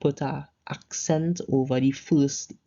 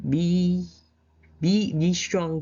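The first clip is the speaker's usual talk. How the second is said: higher, slow, insistent be strong; rallying call to be strong; 4 semitones higher, 3 dB louder, much slower than usual. Shown as a demonstration. Teacher voicing a rallying call to a group, higher and slow. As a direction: urge the group to be strong, drawn out. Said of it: "if you tell a group of people".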